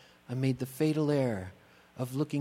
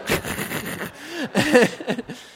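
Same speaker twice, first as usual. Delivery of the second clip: real laugh; 12 semitones higher, 7 dB louder than usual. Real, not acted. Breaks up laughing mid-sentence.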